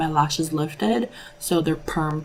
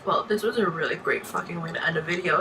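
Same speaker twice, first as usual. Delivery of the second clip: ordinary talk, nothing marked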